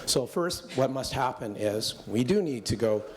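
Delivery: normal talking voice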